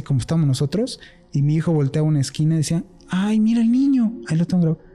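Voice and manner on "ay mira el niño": lightly voiced small child pointing something out; a hint of a two-year-old, a little higher; imitating his toddler son. Dwuletni syn wskazuje w kąt.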